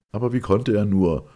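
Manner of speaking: loud, high, scandalised burst; indignant outburst. A narrator's reading. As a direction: blurt it out, offended it could happen. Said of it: "stieß der alte Buchhalter entrüstet hervor".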